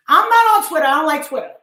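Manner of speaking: high, loud protest; emphatic denial. Real, not acted. Vlogger disowning Twitter, high and loud. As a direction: insist hard, voice jumping up.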